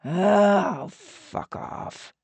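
exasperated, whispered dismissal; scornful brush-off. A narrator's reading. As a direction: groan it, then drop to a breath. Otto dismisses pouting woman.

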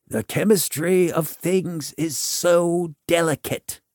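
mild, mystical warning. A narrator's reading. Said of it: "he warned".

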